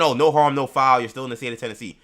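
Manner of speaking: high, loud mocking voice; sneering parody of the fans' excuse; mocking the idiots. Mocking fans who want the team moved. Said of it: "they keep saying".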